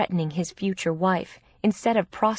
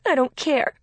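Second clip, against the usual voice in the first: loud, higher-pitched tearful protest; upset, defiant cry; crying harder; 7 semitones higher, 5 dB louder than usual. A narrator's reading. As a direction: protest through tears. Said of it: "Gracie just cried harder".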